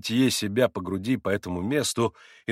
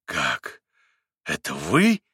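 surprised exclaim; 8 semitones lower, much slower than usual. A narrator's reading. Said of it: "вскричал Митя".

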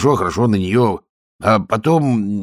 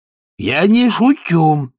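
high, loud, slow growl; a loud, slow, high warning; fierce; 11 semitones higher, 6 dB louder, slower than usual. Acted. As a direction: advance on him with fury. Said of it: "свирепо подступая к нему".

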